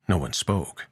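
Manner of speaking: plain reading voice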